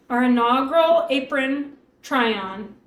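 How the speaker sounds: ordinary delivery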